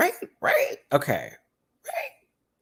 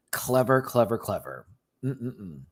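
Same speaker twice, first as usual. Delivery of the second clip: usual speaking voice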